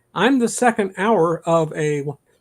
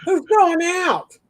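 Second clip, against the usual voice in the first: mock nagging wife; 9 semitones higher than usual. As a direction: play a shrill-sounding nag yelling through the house, much higher.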